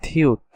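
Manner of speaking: থিউত said as Korean "ti-eut"; teacher naming the letter থিউত; Korean letter name. Shown as a demonstration. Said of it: "তারপরে আসবে".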